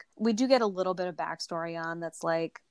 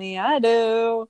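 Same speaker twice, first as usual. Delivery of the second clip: drawn-out, sheepish "yeah, I do" a bit louder; slow, sheepish confession; sheepish admission; 4 semitones higher, 6 dB louder, slower than usual. Retold or imitated. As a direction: own up slowly like a caught stalker. Sabrina admitting the picture.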